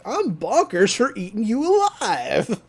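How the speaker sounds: put-on cereal mascot voice, pitch swooping; cartoon mascot. Cereal box bird to the trapped teen, cartoonish. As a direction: imitate a manic cartoon bird slogan.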